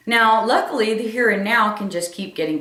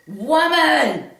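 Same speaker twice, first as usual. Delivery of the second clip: scream; 7 semitones higher than usual. Retold or imitated. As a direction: bellow it out to silence her. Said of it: "I just screamed".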